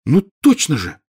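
dawning amazement, rising high; stunned. A narrator's reading. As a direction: catch on in astonishment. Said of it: "ошеломлённо произнесла Гермиона".

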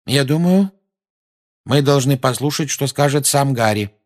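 lightly voiced Lupin; Lupin's voice. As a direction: voice him steadily persuading.

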